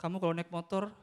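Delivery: gentle mother warning. Acted. Mother warns son gently.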